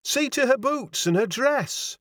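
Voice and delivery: high, agitated landlady; flustered Yorkshire landlady, pitch way up; wild cry. A narrator's reading. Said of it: "cried the landlady wildly".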